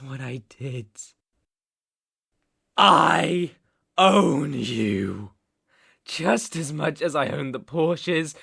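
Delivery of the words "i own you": loud, weighty, falling line; possessive declaration. Acted. Captor claims ownership of rabbit, possessive declaration. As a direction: land each word as a claim.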